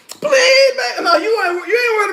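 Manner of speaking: hammed-up tearful begging; fake crying plea. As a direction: cry out to keep him there. Delilah begging him to stay.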